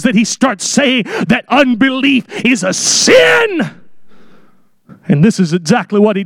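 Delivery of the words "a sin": a sin shouted high; preacher shouting a sin; emphasis. Real, not acted. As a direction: hit sin hard and high.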